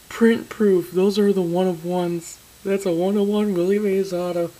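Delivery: almost shouting the card name; excited disbelief. Real, not acted. Still reacting to the pull.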